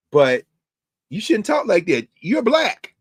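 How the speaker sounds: hammed-up loud objection; mock scolding objector. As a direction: interrupt with a high, incredulous scolding. Acted objection to the MAGA caricature.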